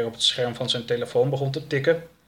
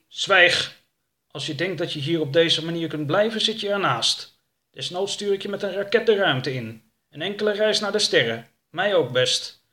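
raised, forceful character voice; threatening boss; 6 semitones higher, 4 dB louder than usual. Acted. Kemp to captive claiming another planet, stern threat. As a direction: threaten the captive, pitched up and firm.

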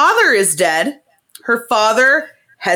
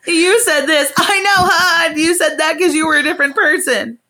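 higher, teasing fake laughter; mock teasing laugh; 5 semitones higher than usual. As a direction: rub it in with a smug giggle. Girls voiced mocking the men.

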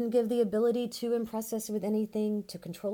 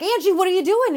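much higher, louder alarmed shout; frantic yell; 11 semitones higher, 15 dB louder than usual. Retold or imitated. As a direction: yell after her in a panic. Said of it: "And I was like".

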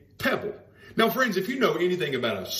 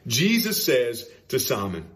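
normal talking voice

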